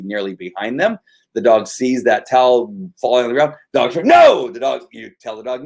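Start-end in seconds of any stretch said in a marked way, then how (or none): 4.0-4.5 a loud, high barked "No!"; sharp correction shout. Retold or imitated. Trainer telling the dog off at the falling towel, a sharp loud no. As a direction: snap the dog out of it.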